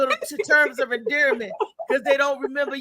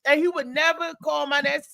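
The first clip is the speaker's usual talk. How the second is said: ordinary talk, nothing marked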